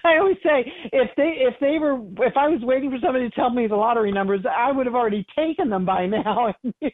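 high, amused voice breaking into laughter; laughing. Real, not acted. Medium joking about lottery numbers with host, laughing.

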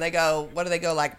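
ordinary delivery

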